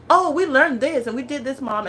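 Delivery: put-on bubbling over like a kid home from school; excited kid. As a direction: son telling mom about his day. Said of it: "he's so excited".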